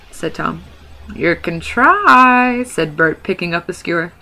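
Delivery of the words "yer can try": high, loud, very slow troll threat; menacing troll. Acted. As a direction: drag it out, skewer in hand. Bert picks up the skewer.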